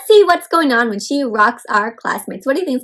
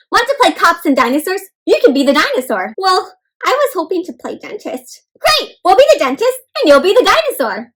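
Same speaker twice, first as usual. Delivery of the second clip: classmates' voices; 6 semitones higher, 6 dB louder than usual. Acted. Voicing the classmates' speech bubbles.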